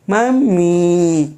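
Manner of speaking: put-on a long, drawn-out child's call of mummy; child calling mummy. Teacher voicing the child calling his mother, drawn out. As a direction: stretch out mummy like a little boy pleading.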